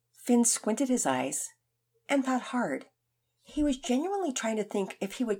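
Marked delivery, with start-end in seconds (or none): none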